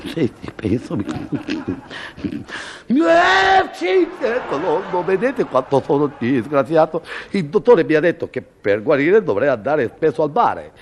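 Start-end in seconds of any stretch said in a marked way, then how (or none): 2.9-4.6 comic sneeze. Acted. Sneeze build-up.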